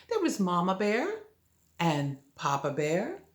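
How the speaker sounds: ordinary delivery, nothing marked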